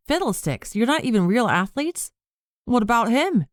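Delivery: high, startled blurt; blurting in shock. A narrator's reading. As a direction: blurt it out, caught by surprise. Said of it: "May blurted".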